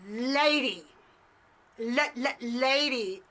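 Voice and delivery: loud, stuttering challenge; confrontational. Retold or imitated. Kung Fu Gary goes up in the lady's face, confrontational. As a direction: imitate a man getting in someone's face.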